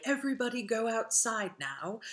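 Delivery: mock radio announcer. Teacher imitating a fake radio order, lightly announced.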